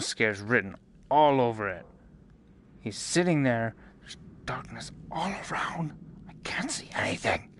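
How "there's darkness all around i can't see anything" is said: nervous whisper, rising; scared whisper. Real, not acted. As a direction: hush it, afraid in the dark. Whispering in dark room.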